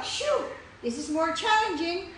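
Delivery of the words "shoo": breath out demo. Shown as a demonstration.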